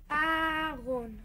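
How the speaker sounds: slow, word drawn out to teach. Acted. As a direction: spell it out for a learner. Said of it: "teaching grandma words".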